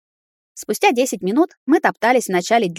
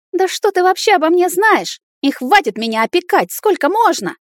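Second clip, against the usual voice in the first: much higher, indignant outburst; 10 semitones higher, 4 dB louder than usual. Acted. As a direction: snap back in outrage. Elf furious at boyfriend.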